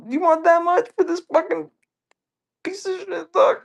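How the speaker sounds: high squeaky mock outrage; mock outraged squeak. To the buddy about the console, a high mock-outraged squeak. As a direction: squeal it like you can't believe the price.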